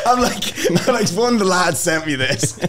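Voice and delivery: high voice breaking into laughter; laughing retelling. Real, not acted. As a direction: laugh through the words in disbelief. Cracking up over his disbelief.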